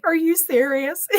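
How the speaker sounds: retelling her shocked question to her husband; stunned disbelief. Retold or imitated. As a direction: ask it as if you can't believe him.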